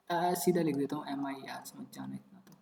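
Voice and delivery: hushed, near-whispered aside to himself; trailing off into a whisper while writing; muttered aside. Real, not acted. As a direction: drop low and soft, almost under the breath.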